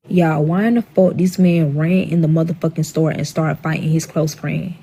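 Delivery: ordinary delivery, nothing marked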